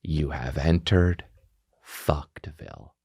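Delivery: low, slow, fading proclamation; ominous announcer. Acted. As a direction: announce doom slowly and low. Ominous announcement of comic disaster.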